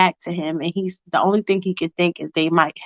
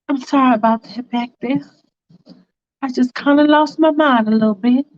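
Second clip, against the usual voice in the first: a lightly voiced girlfriend, raised pitch, apologetic; Faith's voice; 4 semitones higher than usual. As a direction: raise the voice a little for Faith, sorry and sheepish.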